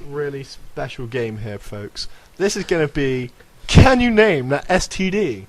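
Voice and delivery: slow, showy host announcement; game show host. Acted. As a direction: present it like a quiz host.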